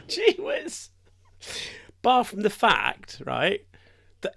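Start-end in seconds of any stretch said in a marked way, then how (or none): 0.1-0.9 squeaky high comic gee whiz; mock alarm. Joking about the clinic, squeaky high mock alarm. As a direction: pipe it up high in mock shock.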